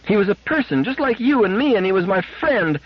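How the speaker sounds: put-on high child voice insisting; fierce little girl. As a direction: stand up for your friend like a child. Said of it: "fiercely and ungrammatically".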